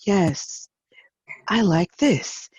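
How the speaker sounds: soft, breathy whispered yes; a lightly whispered gentle yes. Retold or imitated. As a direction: imitate her hushed, gentle agreement. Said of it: "And you're so gentle".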